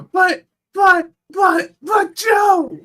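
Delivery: very high, strained yelling; whiny protest. Retold or imitated. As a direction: shriek it like a sore loser. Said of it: "Xander sitting there".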